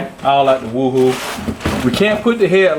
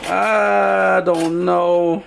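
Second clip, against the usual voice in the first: long, slow, stretched-out words; drawn-out hesitation; 3 semitones higher, much slower than usual. Real, not acted. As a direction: stretch it out as you mull it over. Unsure whether the trim looks chrome.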